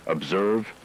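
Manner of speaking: ordinary talk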